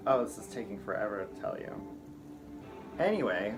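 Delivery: ordinary talk, nothing marked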